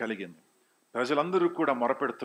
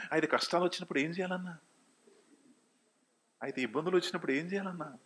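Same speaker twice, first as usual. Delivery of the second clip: quick, slightly raised congregant's query; questioning congregant; 6 semitones higher, 4 dB quieter, much faster than usual. Acted. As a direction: lightly voice a listener's hurried objection. Voices congregation's questioning objection. (విశ్వాసి అడిగినట్టు వేగంగా ప్రశ్న)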